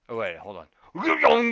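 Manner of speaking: normal talking voice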